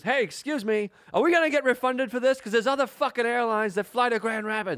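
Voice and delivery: high, loud indignant demand; irate complaint. Retold or imitated. Imitating a passenger demanding a refund from airline staff, irate.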